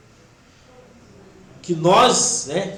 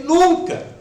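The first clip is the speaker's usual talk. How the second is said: "Nunca" said high and louder as a firm answer; emphatic never; 10 semitones higher, 6 dB louder than usual. Retold or imitated. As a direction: answer the mother with a ringing, certain no. Firm reply to grieving mother. ("Nunca" dito alto e firme, com convicção)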